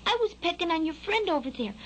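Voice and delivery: high, protesting fledgling voice; young bird defending himself; little Skylark. A narrator's reading. Skylark explaining himself to the scolding worm, high protest.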